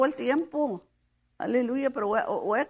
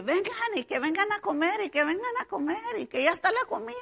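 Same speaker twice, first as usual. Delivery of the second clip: put-on parent calling children; 8 semitones higher than usual. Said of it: "les estoy hable y hable".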